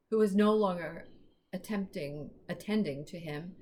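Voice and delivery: ordinary delivery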